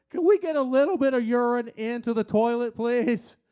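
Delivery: loud, high-pitched exasperated appeal; exasperated plea. Acted. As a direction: plead with the culprits, loud and fed up. Exasperated plea to messy men.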